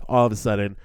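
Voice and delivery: ordinary talk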